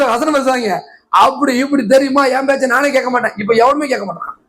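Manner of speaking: usual speaking voice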